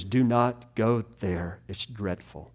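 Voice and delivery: low, slow warning, sinking very soft; grave warning. Acted. Solomon warns against pleasure, grave and hushed. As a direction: warn them off, hushed and low. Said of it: "he's not celebrating all of his accomplishments".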